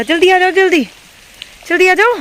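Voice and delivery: high, loud re-enacted shout to hurry; imitating an urgent, high-pitched call; acted hurrying call. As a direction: act out urging them to run over. Acts out her hurry-up call.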